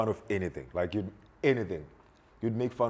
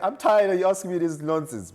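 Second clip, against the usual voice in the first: annoyed mom; 9 semitones higher, 6 dB louder than usual. Retold or imitated.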